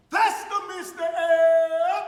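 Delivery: loud, slow teasing call across the street. Retold or imitated. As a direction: call it out to him from a doorway, drawn out. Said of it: "The people tease me".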